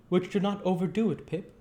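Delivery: simple, caring working man; kindly Joe voice. Acted. Joe answers Pip.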